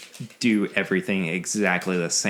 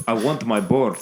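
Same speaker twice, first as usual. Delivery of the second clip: Iron Man villain; 4 dB louder than usual. Pretend. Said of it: "exclusively from Iron Man Two".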